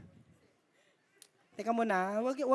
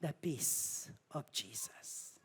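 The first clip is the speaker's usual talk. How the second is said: hushed; 5 semitones lower, 9 dB quieter, slower than usual. Real, not acted. Hushed sermon point.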